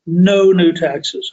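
each word of 'No new taxes' spaced out slowly, NO stressed; mild imitation of a campaign promise; emphatic slow quote. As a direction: lean on the pledge, slow and deliberate. Quoting Bush's pledge speech.

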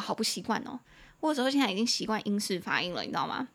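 ordinary talk, nothing marked

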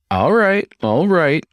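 high, loud giving in; conceding. Acted. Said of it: "I conceded".

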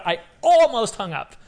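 high-pitched, flustered exclamation; flustered excitement. Retold or imitated. Fan recalling a surprise call from the idol, flustered excitement. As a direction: blurt it high, still reeling. Retold panic at idol's call.

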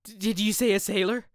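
can't believe what he hears; stunned stammer. Acted. Dorian presses the gamekeeper, stammering in alarm.

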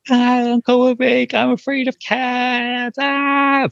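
put-on high, slow, frightened little girl's squeal; mock a child screaming about a cat; imitating scared niece. Uncle imitates his niece screaming in fear of a cat. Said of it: "she immediately started to scream".

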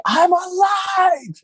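very high, drawn-out retold shout; exultant yell. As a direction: yell it out in joyful relief. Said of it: "I wanted to yell it at the top of my lungs".

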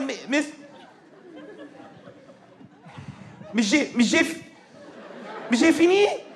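stammered, rising attempt at the name; tentative calling. Timid pupil tries calling teacher.

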